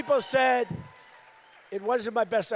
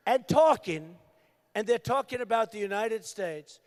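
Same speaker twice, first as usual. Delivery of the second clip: usual speaking voice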